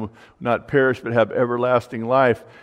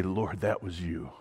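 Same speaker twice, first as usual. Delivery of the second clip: hushed prayer; 4 semitones lower, 9 dB quieter than usual. Retold or imitated. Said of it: "where we say".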